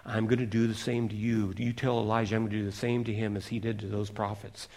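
low, soft menacing threat from a queen; a quiet villainous queen; a hint of a scheming queen; wicked queen. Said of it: "this one wicked queen says".

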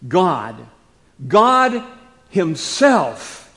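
loud, high, slow preaching emphasis; hammered-out, weighty declaration; emphatic scripture reading. Acted. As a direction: drive each phrase home with force and pauses. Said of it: "Paul uses the emphatic Greek construction".

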